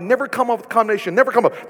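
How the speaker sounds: ordinary delivery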